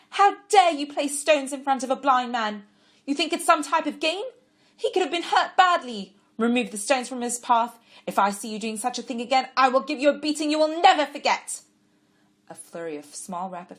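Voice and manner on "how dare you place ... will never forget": put-on high, loud tirade at the children; furious scolding. A narrator's reading. Woman scolding children who tripped a blind man, furious shout. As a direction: scold them hard for the cruel prank. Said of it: "yelled furiously".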